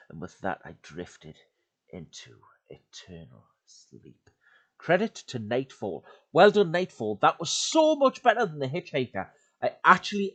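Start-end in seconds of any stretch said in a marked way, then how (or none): 0.1-4.3 tense, dramatic horror story narration. A narrator's reading. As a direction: read it like a creepy campfire tale. Reads horror story aloud.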